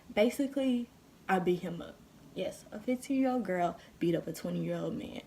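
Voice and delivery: ordinary talk, nothing marked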